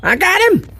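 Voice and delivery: excited. Real, not acted. Catching a soldier fly, excited.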